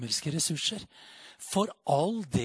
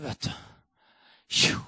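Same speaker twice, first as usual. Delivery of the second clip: usual speaking voice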